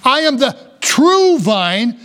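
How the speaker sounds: "true" stretched out and pushed high; slow down and lean hard on "true"; high, slow line with a drawn-out "true"; stress on true. Shown as a demonstration. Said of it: "the emphasis here is on the word true".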